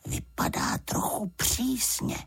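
slow, high, half-whispered aside; hushed remark. A narrator's reading. Paddington watches the housekeeper walk off, furtively hushed. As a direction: murmur it so she won't hear.

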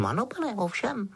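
high elf voice, in earnest; an eager but serious little servant; Dobby, earnest. A narrator's reading. Elf answering why he came, earnest. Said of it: "řekl Dobby vážně".

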